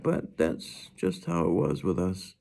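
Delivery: ordinary delivery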